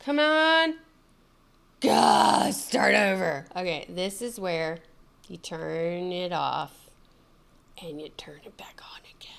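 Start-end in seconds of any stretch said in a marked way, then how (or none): 0.0-3.4 exasperated shout at the screen; frustrated outburst. Real, not acted. Frustrated at failed login. 7.8-9.3 whisper. Real, not acted. Quietly doing steps.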